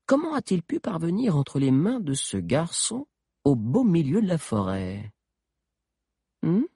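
a high, insinuating witch voice; sorcière. Acted. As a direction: probe him with sly menace. Witch questioning the historian. (voix de sorcière insinuante)

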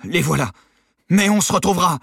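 loud, high parting threat; defiant surrender. A narrator's reading. Guard hands over keys to escapees, defiant shout. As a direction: give in, then shout a threat.

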